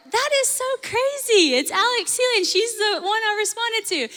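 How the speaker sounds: retold excitement at spotting the preacher; thrilled disbelief. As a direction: gasp it out like you can't believe it.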